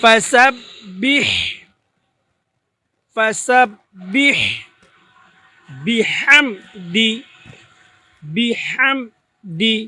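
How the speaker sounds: ফাসাব্বিহ বিহামদি slow, each syllable drawn out, breathy হ; correct Arabic pronunciation. Shown as a demonstration. Teacher models the right way: ফাসাব্বিহ বিহামদি drawn out. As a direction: recite ফাসাব্বিহ বিহামদি slowly and carefully. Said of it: "চেষ্টা করেন".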